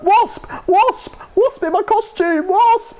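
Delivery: very high panicked squeal; mock panic. Man with a wasp in his costume, mock panic. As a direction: squeal it in hammed-up terror.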